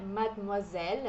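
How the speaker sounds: Mademoiselle with a silent D, in French; French pronunciation. Shown as a demonstration. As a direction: Mademoiselle as madmuazel. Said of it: "é como se esse D fosse mudo". (Mademoiselle com o D mudo)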